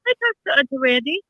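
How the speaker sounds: a lightly voiced toddler, matter-of-fact; small child voice. Mother imitating her little son, a lightly voiced child.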